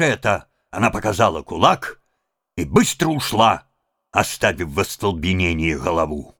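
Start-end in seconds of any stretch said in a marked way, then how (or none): none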